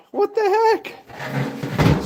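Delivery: drawn-out high what the heck; surprised. Real, not acted. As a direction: stretch it out in disbelief. Finding mouse mess.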